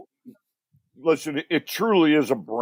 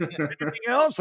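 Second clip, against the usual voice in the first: higher mock wife voice; husband imitates his wife's question in a higher voice; mimicking his wife; 7 semitones higher than usual. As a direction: play the wife, pitched up and light. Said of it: "She goes".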